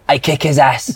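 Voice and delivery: lightly imitated Namibian accent. Namibian teammate boasting about Essien to roommate, Namibian accent. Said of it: "Why would you morph into Gravis".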